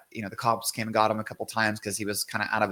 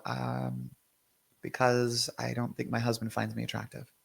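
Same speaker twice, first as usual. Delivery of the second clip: hesitant, softened client confession; quiet admission; 7 dB quieter than usual. Retold or imitated. Client admitting doubts about her husband, quietly. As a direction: drop the voice after a hesitant "Um".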